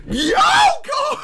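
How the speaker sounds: high, loud whoop into laughter; real hyped laughing shout; ecstatic laughing yell. Real, not acted. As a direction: scream with laughing joy. Ecstatic reaction to panel.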